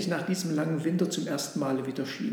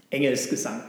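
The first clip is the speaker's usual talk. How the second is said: firm insistence, no question left; 4 dB louder than usual. Acted. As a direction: state it as settled. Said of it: "sagte der Esel bestimmt".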